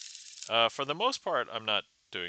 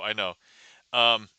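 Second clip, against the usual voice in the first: usual speaking voice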